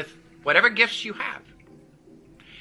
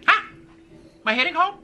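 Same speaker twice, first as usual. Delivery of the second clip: ordinary talk, nothing marked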